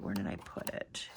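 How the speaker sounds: soft unvoiced mutter while searching; muttering to herself. Real, not acted. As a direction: whisper it under your breath.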